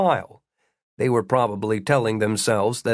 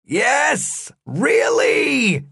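exasperated high shout; 12 semitones higher, 3 dB louder, much slower than usual. A narrator's reading. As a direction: yell it at him, fed up. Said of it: "the guard shouted".

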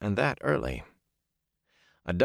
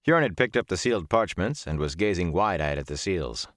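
ordinary delivery, nothing marked

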